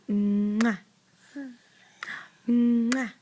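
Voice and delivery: smacking kisses; kiss sounds. Shown as a demonstration. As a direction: kiss noises for the toddler. Mother shows kiss sound.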